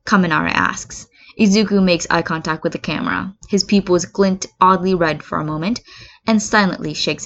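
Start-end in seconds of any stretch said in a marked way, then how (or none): none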